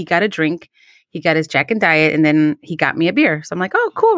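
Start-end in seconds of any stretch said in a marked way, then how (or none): 3.7-4.2 excited. Retold or imitated. Excited at date moment.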